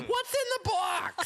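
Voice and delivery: high-pitched hammed-up anguish; mock anguished cry. Comic acting out the climax of Seven, mock anguished cry. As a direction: wail it like an overwrought movie hero.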